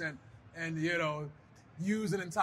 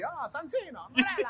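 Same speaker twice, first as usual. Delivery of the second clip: higher, exaggerated Italian ring voice; Italian character accent; 8 semitones higher than usual. Wrestler mocking Santino's put-on Italian accent on entrance. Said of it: "he'd have to come out and be".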